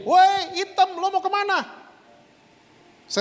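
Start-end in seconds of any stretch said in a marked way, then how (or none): 0.0-1.6 high taunting mock shout; imitated bully's yell. A schoolmate yelling at his daughter, high mock taunt. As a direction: call out a jeering kid's insult from across the schoolyard. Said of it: "Temennya teriak begini".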